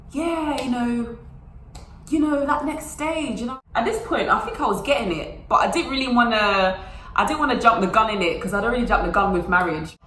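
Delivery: ordinary delivery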